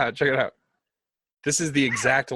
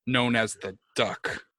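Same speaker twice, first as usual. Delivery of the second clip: bind him with each phrase; commanding dark lord. Acted. Posing as a tyrant god, binding a servant to another, commanding.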